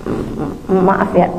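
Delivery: halting, stumbling "M-, m-, maaf ya" said low and soft; grudging stammer, barely getting "maaf" out; stammering apology. Retold or imitated. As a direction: force the apology out past your pride, stuttering. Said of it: "jadi kayak terbata-bata gitu ngomongnya".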